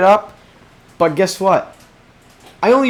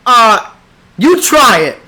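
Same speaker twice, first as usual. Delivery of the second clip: challenging retort; 6 semitones higher, 7 dB louder than usual. Real, not acted. Challenging imagined critics.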